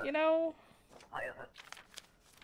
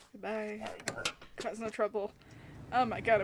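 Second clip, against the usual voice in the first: normal talking voice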